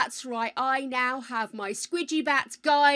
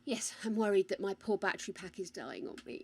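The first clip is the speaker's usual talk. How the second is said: ordinary delivery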